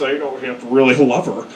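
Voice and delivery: usual speaking voice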